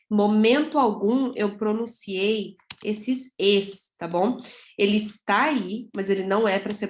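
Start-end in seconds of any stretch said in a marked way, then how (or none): none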